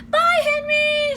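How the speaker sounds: high slow sing-song goodbye; girlish farewell. Acted. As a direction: call a sweet goodbye. Annie bids farewell.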